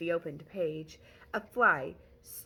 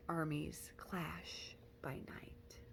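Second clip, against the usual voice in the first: whisper; 10 dB quieter, much slower than usual. Acted. Poem's final line fades out.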